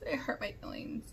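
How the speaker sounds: soft hurt whisper; half-joking whispered admission. Real, not acted. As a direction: breathe it out like a confession. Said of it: "Not really, no".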